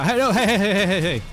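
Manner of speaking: high laughing hey hey; laughing protest. Real, not acted. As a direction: cry out through a laugh.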